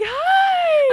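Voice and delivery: a very high, loud squeaky Hi; high kid-reporter hi. Retold or imitated. Mocking a reporter greeting a kid, very high and loud. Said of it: "my voice changes when I'm interviewing kids".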